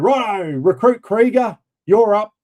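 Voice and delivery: academy instructor. Retold or imitated. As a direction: imitate an instructor calling out the next recruit. Voicing the academy instructor calling a recruit.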